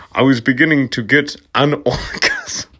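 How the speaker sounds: breaking into laughter mid-line; laughing through it. Real, not acted. Reader cracking up while reading the fanfic aloud, laughing. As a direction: try to read it but crack up.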